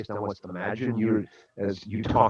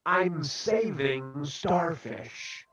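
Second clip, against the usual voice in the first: lightly voiced eager boy, higher pitch; high, soft, slow enthusiasm; enthusiastic kid; 7 semitones higher, 4 dB quieter, much slower than usual. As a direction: imitate a keen child, drawing out the last words proudly. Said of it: "with so much enthusiasm".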